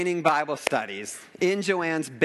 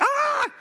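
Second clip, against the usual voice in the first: sudden high, loud cry of pain; short hammed-up shriek; acted pain scream; 8 dB louder than usual. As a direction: imitate a yelp of agony. Acts out injured woman's pain cry.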